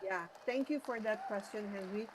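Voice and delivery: faint, distant, far-off mic; speaking too far from the mic; barely audible. Real, not acted. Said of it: "your voice is very low".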